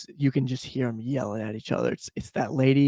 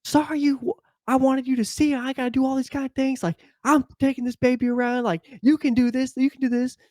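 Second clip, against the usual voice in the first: much higher, mock-pleading lady; pleading woman; 11 semitones higher than usual. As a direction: whine like the upset mother in the driveway. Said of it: "she's like".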